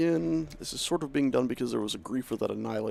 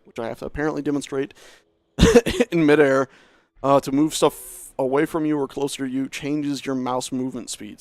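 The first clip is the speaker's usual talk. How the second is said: usual speaking voice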